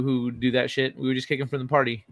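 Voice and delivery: ordinary delivery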